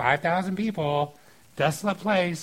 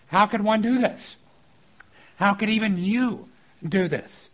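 higher, louder disbelieving disciples; mock shock; 5 semitones higher, 4 dB louder than usual. Disciples doubting Jesus can feed the crowd, shocked. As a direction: question it in raised-voice astonishment. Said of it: "They're shocked".

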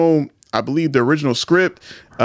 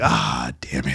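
disappointed groan; 14 semitones lower, 6 dB quieter, slower than usual. Retold or imitated. Imitating disappointed reaction.

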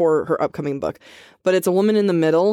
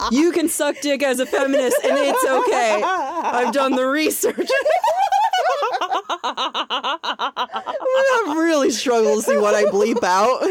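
giggling through the words; laughing; 7 semitones higher, slower than usual. Real, not acted. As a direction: speak while cracking up.

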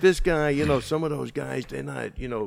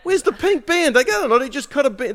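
loud mock exasperation; 9 semitones higher, 4 dB louder than usual. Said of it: "He's like".

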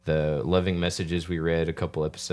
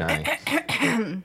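slowed pitched voice effect. Shown as a demonstration. Voice effect button.

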